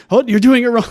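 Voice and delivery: high, loud imitation of a scolding mentor; mock movie mentor correction. Quoting a surfboard-carving mentor from a film, mock correction. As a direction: call out a correction, playfully imitated.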